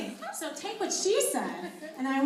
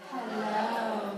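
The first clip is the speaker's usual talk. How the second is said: low, seductive hello; sexy hello; 4 semitones lower than usual. Shown as a demonstration. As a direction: breathe it out seductively. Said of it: "say hello in a really slow and sexy voice".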